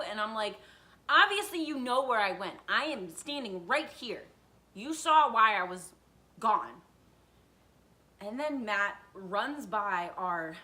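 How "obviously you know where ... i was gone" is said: loud, emphatic mock-defiant comeback; indignant retort. Daughter answers dad's questions, acted indignant retort. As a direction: throw it back at him, punching words. Acts her retort to dad.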